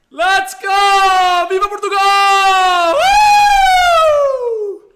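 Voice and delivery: very high, loud victory shout; patriotic cheer. Real, not acted. Streamer cheering his nation's Euro qualification, very high loud shout. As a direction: belt the cheer for Portugal.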